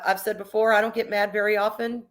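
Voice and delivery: normal talking voice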